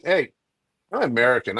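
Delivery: ordinary delivery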